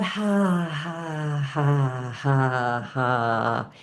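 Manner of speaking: long, drawn-out ha's stepping down in pitch; breathed-out ha. Shown as a demonstration. Teacher warms up the diaphragm, long exhaled ha's. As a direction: let each "ha" sink as the breath empties. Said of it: "and as you breathe out".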